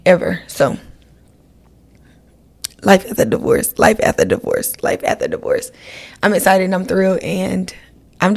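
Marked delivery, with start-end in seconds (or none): 2.8-5.7 whispered echo, each repeat lower and softer; fading whisper echo. Pretend. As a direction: let the title fade away like an echo. Dramatic repeated title, fading away.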